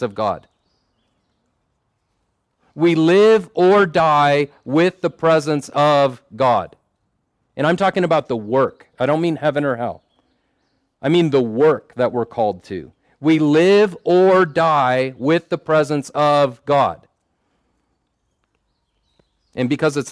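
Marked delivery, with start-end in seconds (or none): 2.7-6.8 slow, weighty, every word leaned on; emphatic repetition. Real, not acted. Preacher drilling the key line into the congregation, slow and emphatic. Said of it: "we have to emphasize something by repeating it three times". 13.2-17.1 slow, weighty, every word leaned on; emphatic repetition. Real, not acted. Preacher drilling the key line into the congregation, slow and emphatic. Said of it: "we have to emphasize something by repeating it three times".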